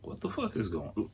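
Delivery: half-voiced stunned mutter; muttered disbelief. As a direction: mutter it in confusion. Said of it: "And I'm just like".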